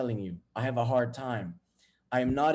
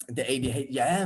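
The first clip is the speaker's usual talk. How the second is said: ordinary talk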